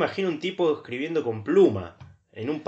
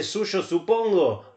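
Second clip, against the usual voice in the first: slow, curt suyo, supongo; sulky letter ending after a quarrel; cold sign-off; 7 semitones higher, much slower than usual. Shown as a demonstration. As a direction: drop the sign-off like you're mid-fight. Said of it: "me suena que están peleados".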